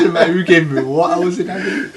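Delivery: laughing question; teasing through laughter. Real, not acted. Teasing friend back, laughing.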